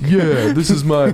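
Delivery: Brooklyn accent. Shown as a demonstration. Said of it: "this is my Brooklyn accent".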